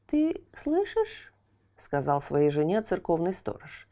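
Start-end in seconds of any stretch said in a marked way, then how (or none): 0.1-1.3 put-on high, slow, puzzled old watchman; surprised watchman. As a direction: ask it high and slow, amazed. Watchman asks his wife.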